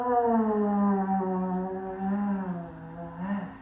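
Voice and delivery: very long, low sigh that slowly falls away; empty the lungs in one drawn-out audible sigh; long audible exhale. Shown as a demonstration. Yoga teacher modelling a big deep breath, long audible exhale. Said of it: "Finding that long audible exhale".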